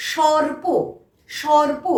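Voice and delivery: teacher modelling সর্প with a clear র; model pronunciation. Shown as a demonstration. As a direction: sound র clearly before প in সর্প. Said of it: "তার আগে র উচ্চারণ করলাম".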